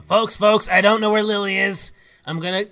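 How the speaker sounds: loud urgent call. Acted. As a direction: raise the voice to the group. Ogden calling out to party.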